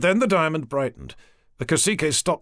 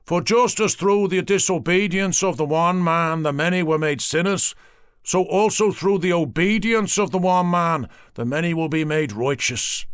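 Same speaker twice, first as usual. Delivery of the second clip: intoned scripture, a touch higher; Bible quote; 6 semitones higher, 4 dB louder than usual. Acted. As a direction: preach the verse. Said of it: "He quoted from the Bible".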